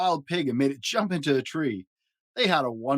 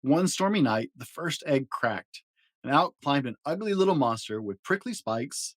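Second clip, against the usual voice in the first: plain reading voice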